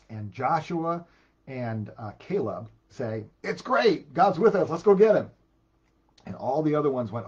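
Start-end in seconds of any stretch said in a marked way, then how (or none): 3.4-5.3 high, loud, fired-up call; rally the troops to charge; eager rallying cry. Retold or imitated. Joshua and Caleb urging Israel to take the land, excited.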